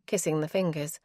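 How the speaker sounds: usual speaking voice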